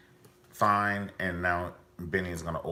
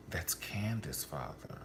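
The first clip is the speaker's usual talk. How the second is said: whisper; 7 dB quieter, slower than usual. Real, not acted. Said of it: "whispered reveal".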